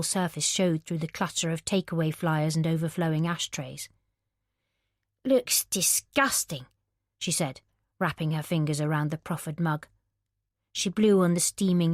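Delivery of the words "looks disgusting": disgusted Lauren. Acted. Lauren voiced, disgusted at drink.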